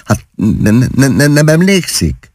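high, flustered stutter; rattled, stuttering question; stammering. A narrator's reading. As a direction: stumble repeatedly on the first syllable.

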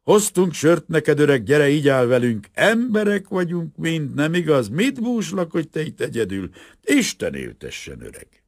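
booze-happy, sloppy cheer; drunk worker. A narrator's reading. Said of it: "félig részegen". (részeg, hangos, vidám ácslegény)

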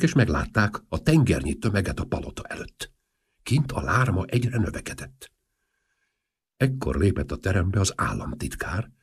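ordinary delivery

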